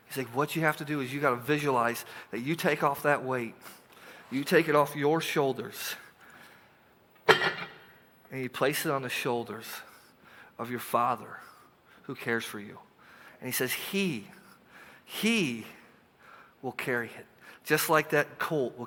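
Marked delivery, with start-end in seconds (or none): 0.4-12.8 tender reassurance. Acted. Preacher voicing Peter, tender. 13.7-17.3 soft promise. Acted. Tender promise, pauses.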